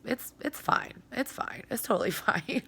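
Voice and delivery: unvoiced, self-mocking whisper; whispered it's fine. Real, not acted. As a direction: whisper it to reassure yourself. Self-reassuring whisper about slow blanket.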